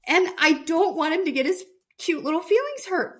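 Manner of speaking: high teasing sympathy. Real, not acted. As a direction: tease about his cute little feelings. Mocking husband's hurt feelings.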